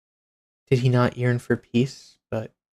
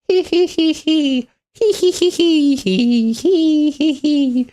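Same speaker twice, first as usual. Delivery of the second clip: high, louder hee hee giggle; psychotic giggle; 5 dB louder, slower than usual. Shown as a demonstration. Mocking an insane villain, high psychotic giggle. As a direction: giggle like a gleeful maniac. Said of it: "their evil psychotic laugh".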